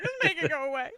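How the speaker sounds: high, loud laughing plea; laughing about the dentist. Retold or imitated. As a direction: beg it gone while laughing. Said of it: "it's like".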